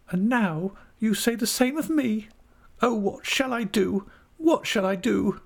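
very high, tearful reproach; melodramatic, high-pitched acted sobbing; sobbing wife. A narrator's reading. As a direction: wail at the husband in a high little voice. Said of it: "sobbed Dora".

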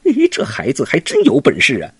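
startled, impressed exclamation; be caught off guard by the kid; monkey king amazed at the child's power; surprised. Acted. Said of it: "孙悟空是吃了一惊啊".

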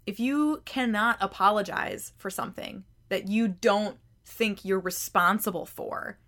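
usual speaking voice

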